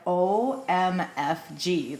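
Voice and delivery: slow letter-by-letter disbelief; spelled-out OMFG. Retold or imitated. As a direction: drag out each letter, stunned. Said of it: "I was like".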